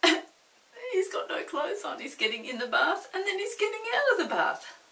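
bubbling over with laughter; giggling at the naked man in the bath; laughing. Real, not acted.

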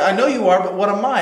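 playground taunt. Retold or imitated. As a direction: tease like a schoolkid.

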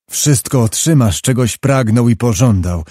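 ordinary delivery, nothing marked

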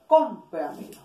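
cómpramelo with a loud, punched CÓM; stress on first syllable. Shown as a demonstration. Teacher showing where the accent mark goes, stress on CÓM in cómpramelo. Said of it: "Sobre esdrújula se tilda".

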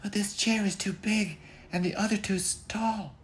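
put-on very high whispered girl voice; girlish whisper. Goldie testing the chairs, high whispery voice. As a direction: breathe it like a sneaky girl.

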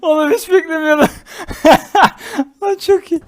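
high, loud words through laughter; laughing hard. Real, not acted. Gamer didn't expect the joke, shouting with laughter. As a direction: shout it out while cracking up. Cracks up, did not expect it.

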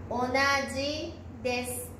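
measured dictation for learners writing it down. Shown as a demonstration. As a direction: read it out piece by piece with pauses. Dictation, word read out in pieces.

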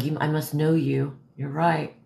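ordinary talk

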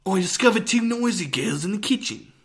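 loud, high, hammed-up British stage accent. Shown as a demonstration. Actor repeating the play line for the co-host, loud British accent. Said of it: "and then my thing was".

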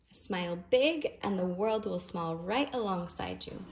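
usual speaking voice

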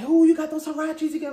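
high, loud mocking sister voice; teasing sister. Sister teasing her about the same sneakers, loud and high. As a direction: tease like a nosy older sister. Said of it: "my sister's like".

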